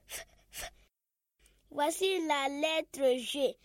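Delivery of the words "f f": "F" as a whispered voiceless f hiss; letter sound F. Shown as a demonstration. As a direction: breathe out "F" with no voice. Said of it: "elle fait toujours F". (son F chuchoté)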